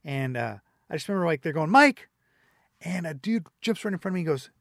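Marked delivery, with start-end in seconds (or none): 1.7-2.1 loud, high call across the counter; calling out a name. Retold or imitated. Barista calls the order name to the crowd, loud high call. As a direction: shout the name over a busy café.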